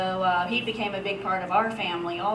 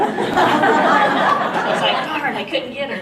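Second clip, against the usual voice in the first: higher, much louder laughter over the words; laughing; 7 semitones higher, 12 dB louder, much slower than usual. Real, not acted. Failing to fool the waitress, real loud laughter. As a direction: laugh through the punchline. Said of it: "I'm laughing and laughing".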